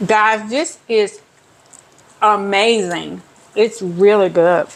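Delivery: talking through a mouthful of food; chewing while talking; mouth full. Real, not acted. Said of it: "I hope I'm not smacking".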